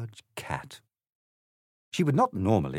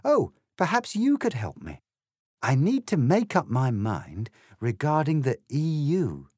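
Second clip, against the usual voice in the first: lightly voiced Alice; 6 semitones higher than usual.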